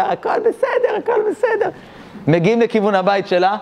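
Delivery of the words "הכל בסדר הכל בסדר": put-on very high, squeaky old-man voice; hammed-up high-pitched elderly reassurance; mock old man. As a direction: imitate the old man in a tiny falsetto. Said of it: "והמבוגר".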